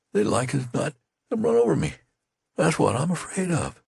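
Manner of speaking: a touch of fearful stammer, high not whispered; shaking whisper. A narrator's reading. Dying soldier confides his fear to Henry. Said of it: "speak in a shaking whisper".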